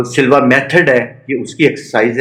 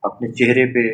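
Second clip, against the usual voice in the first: guided meditation; 4 dB quieter than usual. Real, not acted. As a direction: lead a body scan one short phrase at a time.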